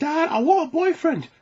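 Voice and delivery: put-on high, loud whiny girl; little girl. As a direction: beg dad like a child. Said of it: "dad and his little girl".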